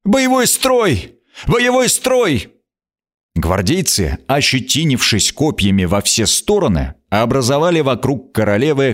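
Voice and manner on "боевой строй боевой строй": slow, high, hoarse yell; hoarse shouting. Acted. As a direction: bellow the order with a torn voice. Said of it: "прокричал сорванным голосом".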